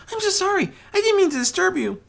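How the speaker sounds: high, hurried flustered apology; a shy pony caught intruding; timid pony apology. Acted.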